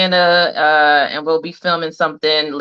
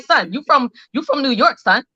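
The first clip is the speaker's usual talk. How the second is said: mock New York slang; 5 semitones higher than usual. Mock confronting a New Yorker.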